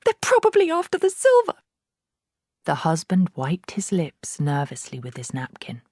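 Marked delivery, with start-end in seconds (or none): 0.1-1.6 very high, loud fretting; worried mother. A narrator's reading. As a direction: fear for the silver. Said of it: "hissed the mother".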